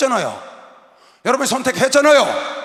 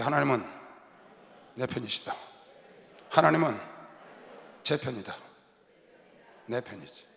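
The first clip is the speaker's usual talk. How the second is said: quiet declaration; 10 semitones lower, 9 dB quieter than usual. Shown as a demonstration. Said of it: "따라서".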